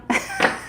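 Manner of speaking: high-pitched burst of laughter; sudden squeal of a laugh. Real, not acted.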